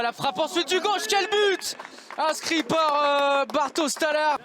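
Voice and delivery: high, thrilled goal exclamation; excited goal call. Real, not acted. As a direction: cry out at the goal.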